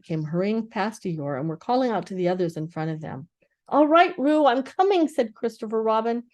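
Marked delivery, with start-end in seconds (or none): 3.7-5.1 higher, louder rescue call. A narrator's reading. Christopher Robin calling out to Roo as he runs. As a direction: shout reassurance across the stream.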